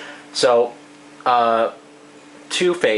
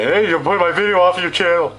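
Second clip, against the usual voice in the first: quick, louder complaining voice; annoyed YouTuber; 7 semitones higher, 5 dB louder, faster than usual. Retold or imitated. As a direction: fire off the complaint in a put-on voice. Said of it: "so he doesn't say".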